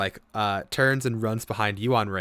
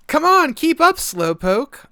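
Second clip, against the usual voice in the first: put-on very high, loud child calling back; teasing little girl; 13 dB louder than usual. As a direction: tease from ahead as she runs. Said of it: "you hear".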